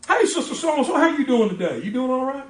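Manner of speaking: high, loud, chirpy greeting; cheery phone greeting. Retold or imitated. Pastor calling a church member on the phone, high cheerful greeting. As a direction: sing it out bright and friendly over the phone.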